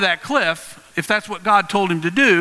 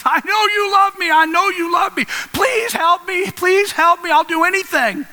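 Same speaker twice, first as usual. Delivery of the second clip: acted high-pitched frantic begging; desperate pleading; 11 semitones higher, 3 dB louder than usual. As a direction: beg urgently, pitched way up, as if about to fall. Man on cliff begs God.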